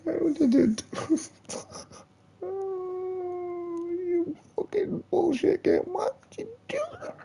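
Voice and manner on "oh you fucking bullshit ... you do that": high, tearful wail of frustration; whimpering sob at the screen; mock crying wail. Real, not acted. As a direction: cry out at the game in despair. Raging at a game glitch.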